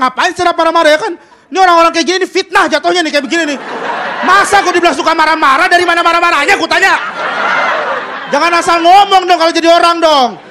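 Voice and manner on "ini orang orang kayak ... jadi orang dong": loud, rising indignant rant; angry rant. Real, not acted. Comic denies being an angry comic while yelling. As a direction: ironic fury, denying being angry. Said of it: "gue lembut kayak begini juga".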